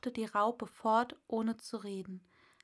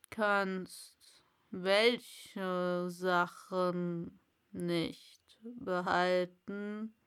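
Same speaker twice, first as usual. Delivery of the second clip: drawn-out caterpillar question; Raupe; 2 semitones lower, much slower than usual. Acted. Raupe voiced. (sehr langsame Raupe)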